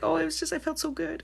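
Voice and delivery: high, put-on client voice, softening; imitating relieved client. Client after a session to the reader, imitated high relief.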